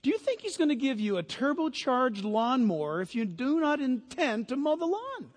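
ordinary delivery

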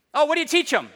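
loud, high-pitched mock hairdresser; gum-chewing hairdresser. Hairdresser quizzing her client mid-haircut, loud gum-chewing caricature. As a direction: exaggerate a brash, chewing-mouthed chatterer. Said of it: "chewing her gum and cutting my hair".

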